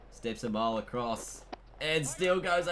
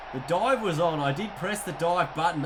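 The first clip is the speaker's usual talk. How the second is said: louder, higher, exasperated insistence; frustrated protest; 4 semitones higher, 5 dB louder than usual. Real, not acted. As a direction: argue you did everything right. Player protests run-out dive.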